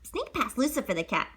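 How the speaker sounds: ordinary delivery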